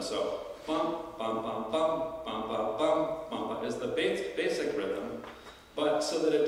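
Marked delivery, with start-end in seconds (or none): 0.7-3.6 six bum-bum-bum beats, long short short; dactylic hexameter. Shown as a demonstration. Teacher chanting a full hexameter line in syllables, rhythmic. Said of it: "so that the bard can sing in that rhythm".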